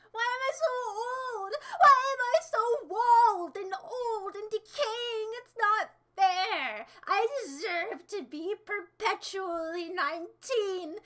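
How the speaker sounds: loud, high, drawn-out melodramatic wail; mock despair. Streamer lamenting getting old, hammed-up despair. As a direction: wail it like a tragic, over-the-top lament.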